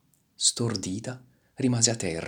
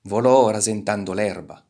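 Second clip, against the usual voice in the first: ordinary delivery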